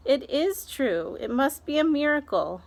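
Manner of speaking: a touch of breathless wonder; amazed aunt. Acted. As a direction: marvel at a miracle.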